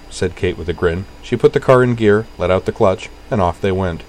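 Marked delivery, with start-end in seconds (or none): none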